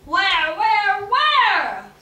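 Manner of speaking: very loud, urgent questioning; the witch demanding the location; eager demand. A narrator's reading. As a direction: press her for the answer, greedy.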